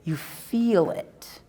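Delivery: ordinary talk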